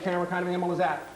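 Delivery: rapid-fire quiz question; breakneck pace. Shown as a demonstration. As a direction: fire the question off fast, teacher drilling a class. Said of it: "moving at this breakneck speed".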